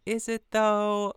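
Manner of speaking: skeptical. Retold or imitated. Jinx doubting the alliance pitch.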